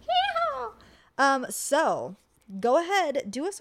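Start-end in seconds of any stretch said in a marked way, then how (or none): 0.1-0.7 high, loud cowboy whoop; yeehaw. Acted. Echoing the cowboy cheer.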